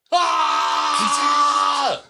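long, high, loud yell; imitated scream. Balcony man screaming whenever driver couldn't hear, full yell. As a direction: scream over whoever is talking. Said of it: "there's this guy screaming".

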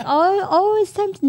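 high, cooing mock wake-up; not like this, sugary. Shown as a demonstration. Mom showing how not to wake kids, cooing. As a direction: coo it high, mocking an over-gentle parent. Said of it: "No, it's not, not like".

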